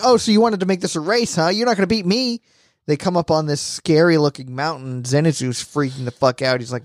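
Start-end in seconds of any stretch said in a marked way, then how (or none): 0.0-2.4 high-pitched mock challenge; competitive taunt. Voicing Zenitsu keeping up in a race, high taunt. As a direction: throw down a high, cocky challenge.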